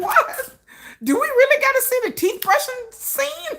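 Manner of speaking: high, disbelieving whine; incredulous. Retold or imitated. Her incredulous reaction retold.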